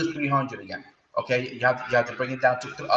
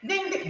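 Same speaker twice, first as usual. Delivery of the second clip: bell ding; 3 dB quieter than usual. Retold or imitated. Imitating a market bell.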